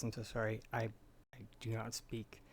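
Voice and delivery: ordinary talk, nothing marked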